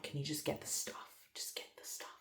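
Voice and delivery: very soft, imitated urgent whisper; retold whisper. Wife asking husband to fetch bags, acted whisper. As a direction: whisper it as a hushed request. Retells urging husband at night.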